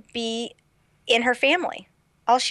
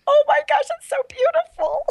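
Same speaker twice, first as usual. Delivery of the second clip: much higher, louder squeal of delight; gushing delight; 4 dB louder than usual. Retold or imitated. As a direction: swoon over the ending, high and loud. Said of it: "And I'm like".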